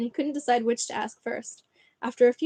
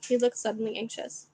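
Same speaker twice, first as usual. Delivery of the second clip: ordinary delivery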